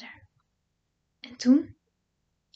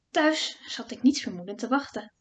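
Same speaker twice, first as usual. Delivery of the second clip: plain reading voice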